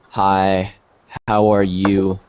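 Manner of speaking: low, flat, unenthusiastic greeting; bored, tired. Shown as a demonstration. Teacher showing students how tone changes meaning, bored and tired. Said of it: "That you are sad, not very happy".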